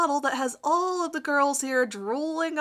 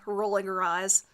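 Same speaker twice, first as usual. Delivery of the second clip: usual speaking voice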